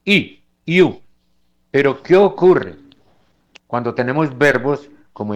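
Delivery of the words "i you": "I, you" said louder and higher with a glide y; demo of you. Shown as a demonstration. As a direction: model "you" with the semiconsonant y. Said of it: "se pronuncia como una semiconsonante".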